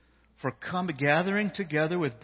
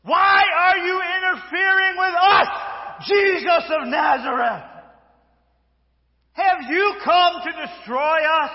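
much higher, much louder demon shout; possessed shout; 12 semitones higher, 9 dB louder, slower than usual. Acted. Possessed man shouting at Jesus of Nazareth. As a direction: shout it at Jesus, wild and high. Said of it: "And he shouted".

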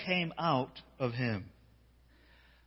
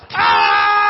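demon scream; 12 semitones lower, 14 dB louder than usual. Acted. Possessed man screaming at Jesus. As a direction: let out a wild demon scream.

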